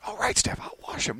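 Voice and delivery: meek, acted whisper; whispered agreement. Husband agreeing to wife's chores, whispering.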